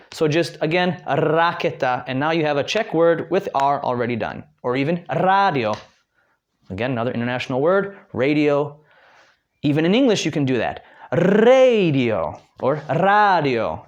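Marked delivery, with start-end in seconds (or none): 1.1-2.0 raketa with a long rolled R; drawn-out R. Shown as a demonstration. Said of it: "dragging out, I'm pulling that R". 11.1-13.8 radio with a long rolled R; practicing the R. Shown as a demonstration. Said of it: "you're still practicing that R".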